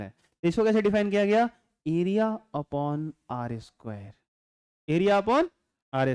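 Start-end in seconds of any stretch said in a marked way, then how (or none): 1.9-4.1 very slow, measured dictation of the formula. Shown as a demonstration. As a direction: draw out "Area upon आर square" word by word. Spells out definition slowly.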